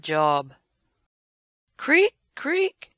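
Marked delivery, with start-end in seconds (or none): 1.8-2.9 high squeaky creak creak; voiced creaking-wood noise; creaking branch. Branch strains under elephant.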